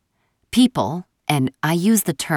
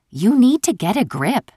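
tentative warning; 7 semitones higher, 4 dB louder than usual. A narrator's reading. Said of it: "eyed the sky tentatively".

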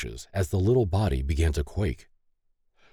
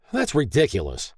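higher, moderately heated exclamation; angry howl; 7 semitones higher than usual. A narrator's reading. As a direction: howl it in exasperation. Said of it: "David howled".

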